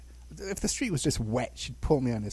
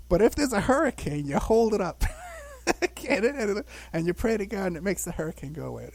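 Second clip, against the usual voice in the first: high, dismissive mom voice; mock mother; 7 semitones higher, 4 dB louder than usual. Mother waving off the child, high dismissive. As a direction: wave the idea away. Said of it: "And she says".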